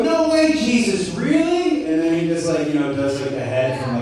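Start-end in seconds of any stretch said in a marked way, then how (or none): 0.0-1.9 mock amazement. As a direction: squeal like a thrilled fan. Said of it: "they go".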